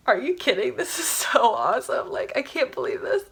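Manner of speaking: hushed, airy disbelief; retold amazement. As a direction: replay your astonishment, trailing into a whisper.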